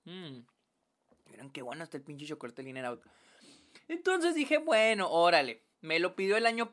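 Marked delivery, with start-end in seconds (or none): 0.1-3.0 while sipping a drink. Real, not acted. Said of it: "Déjame tomo un traguito de chocolate".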